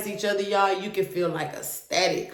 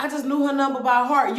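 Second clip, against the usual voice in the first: higher, fast sarcastic imitation; mocking him; 6 semitones higher, faster than usual. Retelling her boyfriend's excuse, mocking him. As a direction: parody his lame excuse. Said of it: "he was just like".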